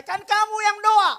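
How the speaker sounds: a hint of a loud, high divine reply; voice of the Holy Spirit. Holy Spirit answering the pastor, lightly voiced. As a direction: toss it back, gently teasing. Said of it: "Roh Kudus bilang sama saya".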